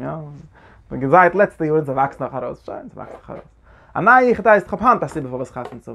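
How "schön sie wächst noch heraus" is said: breathy whisper, much softer; whispered echo. Shown as a demonstration. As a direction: drop to a whisper for the repeat. Repeats phrase then whispers it.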